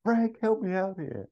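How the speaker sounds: very high whining voice; distraught Ferrell. Retold or imitated. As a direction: plead for help near tears. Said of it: "He goes".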